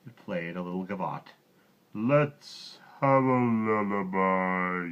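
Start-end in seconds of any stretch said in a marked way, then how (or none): 1.9-4.9 slow, yawning giant voice; slow sleepy request. A narrator's reading. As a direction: yawn it out like a drowsy giant. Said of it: "yawned the giant".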